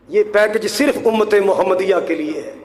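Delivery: normal talking voice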